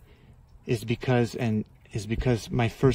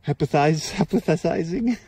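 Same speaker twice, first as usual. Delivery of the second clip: real chuckle while tripping over a word, pitched higher; amused, higher-pitched fumble for the word; laughing at own stumble; 4 semitones higher than usual. Real, not acted. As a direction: stumble through it, half laughing.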